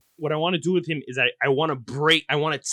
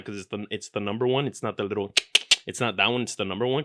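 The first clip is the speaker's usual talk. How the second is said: ordinary delivery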